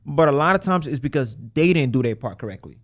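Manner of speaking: ordinary talk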